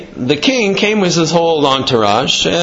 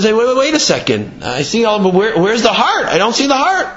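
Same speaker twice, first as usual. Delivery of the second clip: raised pitch, climbing as he questions; puzzled demand; 6 semitones higher than usual. Retold or imitated. Lion asks fox about the missing heart, puzzled demand. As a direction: act a lion querying his dish, higher and higher.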